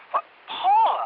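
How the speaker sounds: high, sharp jealous surprise; indignant surprise. Acted. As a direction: pitch leaps in shocked jealousy. Girlfriend hears another name.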